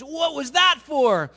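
high, loud, slow furious demand; outraged shout. Acted. Half-drowned man yelling at the guru, high and furious. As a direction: yell it at the guru, gasping and furious. Man gasping after being held under.

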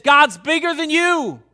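David to Goliath, bold defiant shout; defiant cry. Acted. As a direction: shout it up at the giant.

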